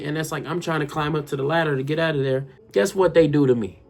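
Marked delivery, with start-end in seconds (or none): none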